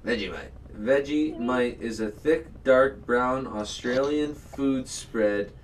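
slow, deliberate reference-book recital; mock encyclopedia read. Shown as a demonstration. Explaining Vegemite to a friend, slow mock-formal reading. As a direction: read it out slowly like a dictionary entry.

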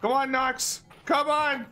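high frantic yelling; being chased. Real, not acted. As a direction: scream at him to hurry. Fleeing, calling teammate.

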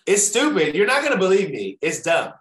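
high, loud, emphatic outburst; exclaimed disbelief. Real, not acted. Guest answering host about first assignment, exclaimed disbelief. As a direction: burst in high and loud, settling a little. Bursts in before own story.

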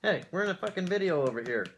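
talking around pipe stem; speaking while smoking pipe; pipe in mouth. Real, not acted. Seen 0: Bearded man in profile holds pipe stem between lips while speaking.

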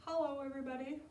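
a hint of a small boy saying hello; lightly voiced little boy. Storyteller introducing Jack to children, lightly voiced boy.

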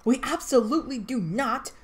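loud, high irritated shout; annoyed shout. A narrator's reading. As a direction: snap it back, annoyed and loud. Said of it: "Ariora shouted, annoyed".

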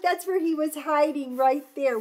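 ordinary delivery

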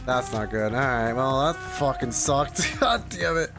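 normal talking voice

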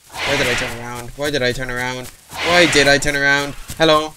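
loud frantic self-blame; why did I do that, rising; panicking gamer. Real, not acted. Caught, self-reproach.

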